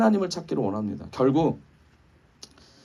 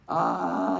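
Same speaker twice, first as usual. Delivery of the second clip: a long, drawn-out, higher mock baby cry "아"; imitated baby wail; 6 semitones higher than usual. Father imitating his hungry baby crying, mock wail. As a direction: stretch out a thin imitation of a hungry baby's cry. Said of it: "이 음소거 되는 울음 아시죠?".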